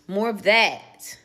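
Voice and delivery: emphatic approval. Real, not acted. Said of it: "repeated approving phrase".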